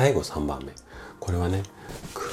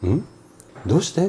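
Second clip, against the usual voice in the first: high-pitched, quizzical question; puzzled listener; 12 semitones higher, faster than usual. Acted. Host voices his puzzled fifties listener asking why, high and quizzical. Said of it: "っていうような顔をしているね".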